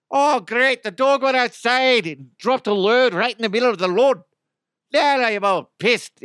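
high, loud mock-Irish complaint; bad Irish accent. Owner fuming about the dog's mess in a bad Irish accent. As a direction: rant in a hammed-up, high-pitched Irish accent. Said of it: "I can't do Irish".

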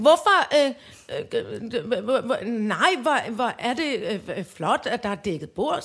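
ordinary talk

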